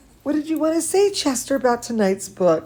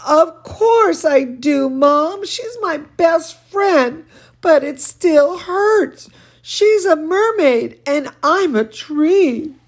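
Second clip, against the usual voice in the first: put-on hurt girl; 7 semitones higher, 4 dB louder than usual. Girl confesses hurt to mom.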